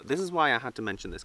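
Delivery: ordinary talk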